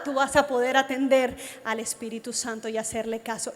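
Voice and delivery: normal talking voice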